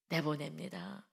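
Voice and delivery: ordinary delivery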